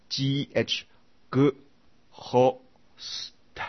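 G, H, then each sound of GHOST separately: g, ho, s, t; every letter sounded. Shown as a demonstration. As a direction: break GHOST into separate sounds with pauses, voicing the H. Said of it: "每一个字哦，都发音哦". (逐音分读ghost，H也发音)

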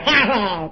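a short high mock laugh; the woman laughing after her foot is scalded, mock ha-ha; retold laugh. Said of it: "他笑起来".

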